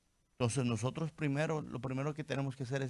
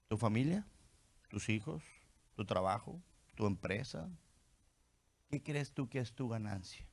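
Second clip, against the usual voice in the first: soft searching questions; 2 semitones lower, 7 dB quieter than usual. Acted. Preacher quietly asks the congregation what they value most, softly and intimately. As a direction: lean in and ask each listener quietly.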